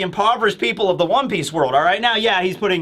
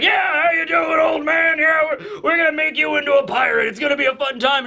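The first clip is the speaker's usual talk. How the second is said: rowdy pirates; 7 semitones higher than usual. Retold or imitated. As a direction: cheer at the newcomer like a rowdy crew. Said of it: "they're like".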